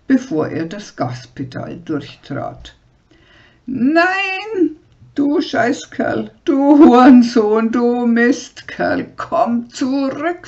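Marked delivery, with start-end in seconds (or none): 3.7-10.5 very high, loud, slow curses; furious screaming. Acted. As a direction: scream insults after the departing camper. Abandoned, screaming after the camper.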